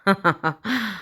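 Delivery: low scornful chuckle; mocking laugh. Badr laughing at the girl's demand, scornful chuckle. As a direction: laugh her off. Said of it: "ضحك عليها".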